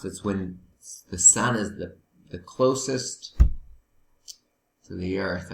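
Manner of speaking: usual speaking voice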